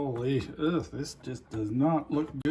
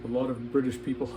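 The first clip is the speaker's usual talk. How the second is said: ordinary talk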